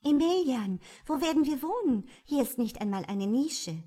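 high, fast, worried princess; fairy tale princess. A narrator's reading. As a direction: fret in a high quick voice. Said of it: "Darauf sagte sie".

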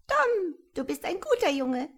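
as calm as can be, like a small bird; calm piping voice. A narrator's reading. Tiny creature calmly praising Tom, high and piping. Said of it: "So gelassen, wie du es dir nur vorstellen kannst".

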